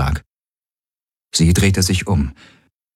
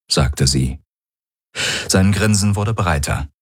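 ordinary delivery, nothing marked

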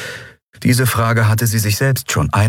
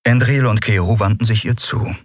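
plain reading voice

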